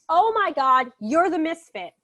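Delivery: mock-alarmed grandmother exclaiming; shocked recognition. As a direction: cry out as if suddenly recognizing someone. Grandmother acted recognizing the outlaw.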